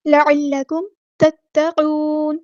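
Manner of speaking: slow, drawn-out recitation; slow Quranic recitation. Shown as a demonstration. Teacher reciting verse words slowly for students. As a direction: stretch every vowel as in tajweed.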